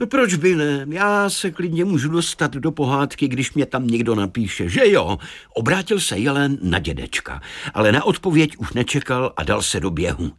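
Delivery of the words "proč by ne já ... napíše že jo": lightly voiced, higher-pitched deer; talking deer. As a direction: argue back as the deer. Narrator plays the talking deer. (lehce zahraný mluvící jelen)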